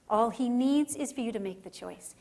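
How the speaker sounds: normal talking voice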